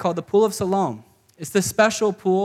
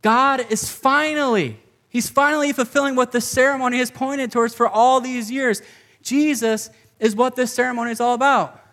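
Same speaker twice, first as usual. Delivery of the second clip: preaching at full force; 4 semitones higher than usual. Acted. Pastor urging his congregation, fervent and raised. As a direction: press the good news home to the crowd.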